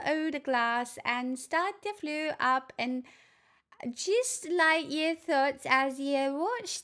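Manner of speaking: soothing Scottish voice leading a visualisation; Scottish accent. Acted. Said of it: "Have my Scottish accent".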